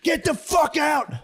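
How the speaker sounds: high, slow, hammed-up yell; mock screaming. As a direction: belt Get the fuck out like the video guy. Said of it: "I spazzed".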